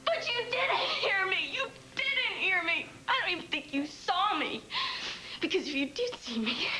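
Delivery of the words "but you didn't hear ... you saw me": tearful screaming memory, voice breaking; crying. Acted. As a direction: relive it through tears.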